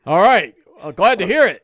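loud, high-pitched hearty reply; Don John. Acted. Latecomer thanked by the party, high loud voice. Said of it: "Don John".

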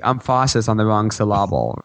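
"emphasis" as em-FASS-is, "syllable" as suh-LAB-ul; misplaced emphasis. Shown as a demonstration. Podcaster joking about botched names, deliberately misplaced stress. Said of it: "You have the wrong".

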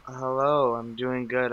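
ordinary talk, nothing marked

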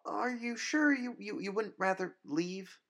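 high, stammering hopeful plea; hope she backs down; hopeful ask. Acted. Grace hopefully asks the vampire to leave. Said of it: "Grace asked, hopefully".

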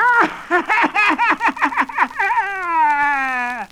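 very high, long falling witch cackle. Acted. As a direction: cackle in triumph. Witch gloats after threat.

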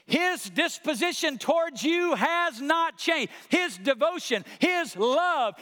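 high, loud, driving sermon climax; full-force preaching. Real, not acted. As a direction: preach it out to the whole room.

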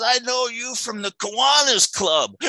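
lightly voiced street drunk, slow and pleased; imitating the drunk rounder. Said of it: "Like that".

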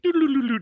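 high, rapid doo-doo unravelling sound; singsong high sound effect; imitated unravelling noise. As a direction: mimic yarn spinning loose.

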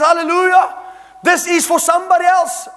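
a wife overjoyed yet refusing to keep it; excited wife. Retold or imitated. Wife insisting the check is for someone else, high and emphatic. Wife's reply in retold argument.

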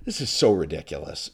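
ordinary talk

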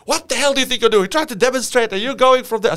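high, loud, fast telling-off; furious rant, acted out; upset sergeant. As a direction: chew out a recruit. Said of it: "He was so upset".

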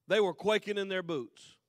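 ordinary delivery

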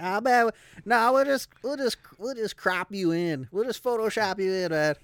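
high, loud mocking dude voice; smug jerk voice. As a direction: lampoon a smug guy brushing you off. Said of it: "And they'll be like".

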